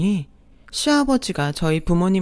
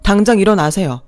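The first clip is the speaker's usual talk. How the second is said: louder, firm furious voice; angry husband; 2 semitones higher, 6 dB louder than usual. Acted. Husband confronting the second mother, loud and furious. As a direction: defend his mother hard.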